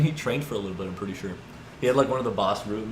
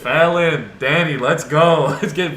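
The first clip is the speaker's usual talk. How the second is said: loud, high urgent call to friends; 6 semitones higher, 10 dB louder than usual. Retold or imitated. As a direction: shout for your friends to come now. Calling friends to come out.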